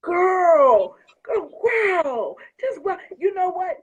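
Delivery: high, loud, drawn-out amazement; overwhelmed. Real, not acted. Overwhelmed reaction to the cards.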